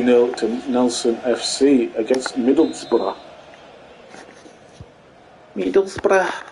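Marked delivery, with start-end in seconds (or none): 5.6-6.4 high-pitched stab at a Scouse accent; attempted regional accent, really Scouse, pitched up; mock Scouse accent. Shown as a demonstration. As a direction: say Middlesbrough high, in badly faked Scouse. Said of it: "that's not the accent, that's actually mainly Scouse".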